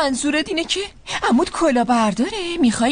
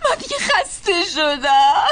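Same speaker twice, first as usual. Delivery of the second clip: crying outburst; 4 semitones higher than usual. Acted. Mother sobs out her exhaustion to the family, wailing. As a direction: break down and cry it out.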